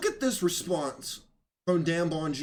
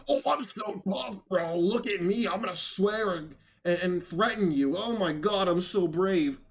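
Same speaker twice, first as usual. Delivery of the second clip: mock tough guy. Host mocking a rival who swears and threatens, mock tough guy. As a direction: send up a swaggering bully. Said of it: "such a tough guy".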